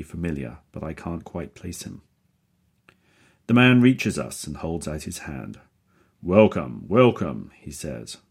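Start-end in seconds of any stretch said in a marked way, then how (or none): 6.2-7.5 loud, hearty booming welcome. A narrator's reading. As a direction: boom out a big welcome. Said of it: "He speaks with a deep, booming voice".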